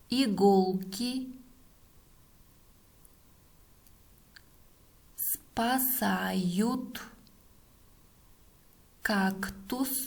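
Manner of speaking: word by word with long pauses; dictating. Shown as a demonstration. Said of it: "последнее предложение". (диктует по словам)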